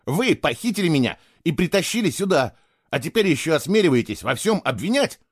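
put-on boy's indignant fury; furious outburst. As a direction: hurl the blame back at her. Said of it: "ярость заглушила страх".

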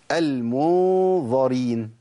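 slowly recited المنظرين with a heavy ẓ; Quran recitation. Shown as a demonstration. A teacher recites المنظرين as a new example. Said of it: "نجيب مثال تاني".

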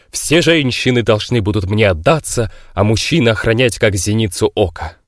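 grave voice. Acted. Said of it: "могильным голосом".

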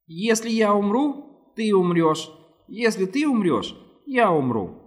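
thin, high whistling alien voice; slow, high, sibilant creature voice. A narrator's reading. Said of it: "тоненьким, свистящим голосом".

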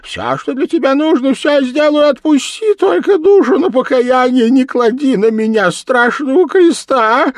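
very high, loud wail; desperate whining demon; piteously pleading devil. A narrator's reading. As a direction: beg in terror in a squealing voice. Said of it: "жалобно простонал чёрт".

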